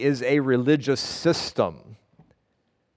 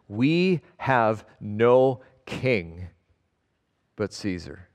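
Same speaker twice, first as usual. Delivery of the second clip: very slow, fading to a hush at Caesar; heavy slow retelling of the crowd's words; slow dramatic repeat; 3 semitones lower, much slower than usual. Retold or imitated. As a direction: drag it out, let Caesar sink away. Preacher re-enacts the crowd's verdict.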